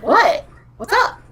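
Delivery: high-pitched, rising what; insistent questioning. Retold or imitated. Mother asks daughter who keeps silently poking her, high and insistent.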